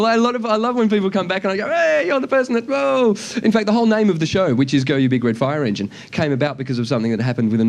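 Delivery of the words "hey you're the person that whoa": high, gushing fan greeting; mock-excited, high-pitched hail. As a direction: call out like a thrilled fan. Excited returning fans acted out.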